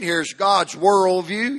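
ordinary delivery

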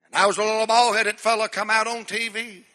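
ordinary talk

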